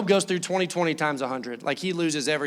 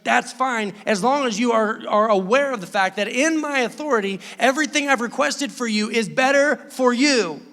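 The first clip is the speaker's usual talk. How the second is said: louder, firm voice of God laying down the terms; forceful God; 6 semitones higher, 6 dB louder than usual. Retold or imitated. Preacher voicing God to the church, loud and firm. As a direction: declare it with full authority.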